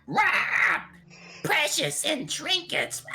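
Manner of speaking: high, slow put-on boss screech; shrill-free high monster boss roar, drawn out; boss voice line. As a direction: ham up a raid boss to scare the group. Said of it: "when I would go".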